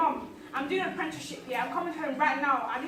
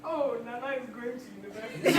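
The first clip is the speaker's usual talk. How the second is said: ordinary delivery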